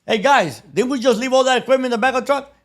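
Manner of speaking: high, loud, fast appeal to the crew; rally the guys with wide-eyed innocence; feigned innocence. Retold or imitated. Valdez calling on his crew to back him, loud innocence.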